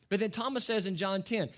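ordinary talk, nothing marked

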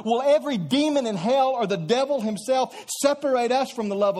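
fiery preacher driving to the answer no; preaching at full force. Acted. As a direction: hammer each question harder. Building litany of rhetorical questions.

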